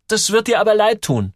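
hoch und laut dagegenhalten; beharrlich. A narrator's reading. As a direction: warne mit drängender, hoher Jungenstimme. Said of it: "beharrt Joel". (beharrender Junge, hoch und nachdrücklich)

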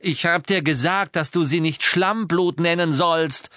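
high, heated rebuke; angry snapping. A narrator's reading. Boy scolding the elf for an insult, angry snap. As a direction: snap at him sharply. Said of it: "fauchte Harry".

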